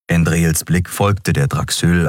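usual speaking voice

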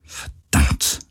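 voiceless muttering under the breath; murmured curse. A narrator's reading. Captain with eyes shut, murmured curse. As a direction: whisper the curse to yourself. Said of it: "murmelte sie".

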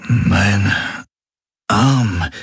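slow, drawn-apart words; groggy and weak. A narrator's reading. Patient notices his changed arm, weak and halting. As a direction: falter between the words.

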